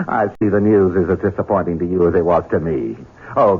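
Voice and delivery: ordinary delivery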